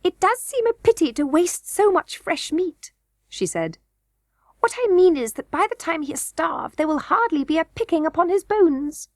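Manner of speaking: high, fussy goblin queen voice; a greedy queen eyeing fresh meat. A narrator's reading. Goblin queen to the king, high and fussy.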